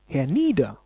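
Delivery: ordinary talk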